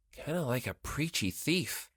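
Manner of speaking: idle thought. Acted. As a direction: muse it idly under the breath. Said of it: "he thought idly".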